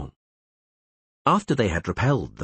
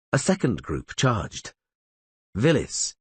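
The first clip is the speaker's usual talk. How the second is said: plain reading voice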